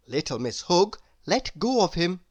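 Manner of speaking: usual speaking voice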